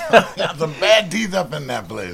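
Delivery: real laughter through the words; laughing. Real, not acted. Interviewee cracking up with the interviewer over bad teeth, laughing. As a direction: chuckle through the joke.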